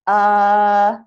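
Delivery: UH held long and loud on a level pitch; long, isolated uh; schwa sound. Shown as a demonstration. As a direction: demonstrate uh as a drawn-out schwa. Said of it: "and it's pronounced".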